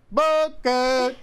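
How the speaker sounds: high, loud overdone call; yelling Booker. Pretend. Friend mimics parent yelling for a lost kid. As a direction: holler the name as if lost in a crowd. Mock calling kids' names.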